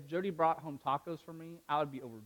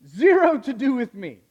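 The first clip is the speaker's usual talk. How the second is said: emphatic loud; 6 semitones higher, 11 dB louder than usual. Real, not acted. Preacher stressing God's grace.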